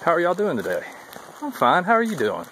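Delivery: lightly acted friendly southern hikers; friendly southern exchange. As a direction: sing out the trail greeting. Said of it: "just a ritual down south".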